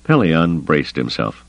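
usual speaking voice

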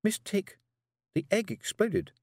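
put-on blunt, high child voice; girl voice. Tiffany line.

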